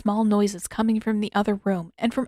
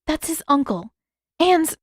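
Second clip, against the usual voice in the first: hasty; 8 semitones higher, 4 dB louder than usual. A narrator's reading. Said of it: "Katara inserted hastily".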